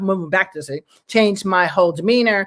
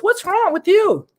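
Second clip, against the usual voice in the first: higher, slower, voicing the kind cab driver; cab driver's concern; 11 semitones higher, slower than usual. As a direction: ask it high and slow like the caring driver. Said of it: "and he goes".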